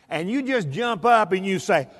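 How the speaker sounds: usual speaking voice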